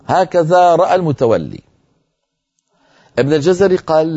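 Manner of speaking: normal talking voice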